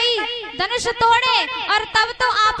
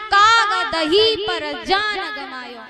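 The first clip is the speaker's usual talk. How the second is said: slow verse with काग and दही as separate words; measured line, दही kept whole; split as काग, दही; slower than usual. Shown as a demonstration. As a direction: recite slowly, parting "काग" from "दही". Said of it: "यानी दही खा के".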